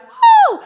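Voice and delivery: high, loud "woo!" whoop; excited cheer. Acted. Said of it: "clapping in excitement and be like".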